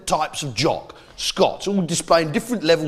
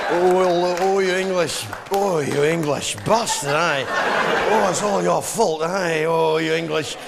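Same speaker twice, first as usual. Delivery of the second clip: lower, slow moaning Scottish grumble; pleasured Scotsman; 3 semitones lower, slower than usual. Acted. Scotsman getting a warm chamois rub while cursing, pleasured moaning. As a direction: moan the insults as if being pleasurably rubbed. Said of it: "sounds like he's having his genitals rubbed".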